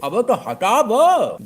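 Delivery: drawn-out comic bellow; mock yell. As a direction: bellow the order at the shopkeeper. Know-it-all orders word removed.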